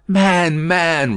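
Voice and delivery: impatient outburst. A narrator's reading. Carton cries out at the stalling spy, impatient and loud. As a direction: snap at him to stop wasting time. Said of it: "stamping his foot".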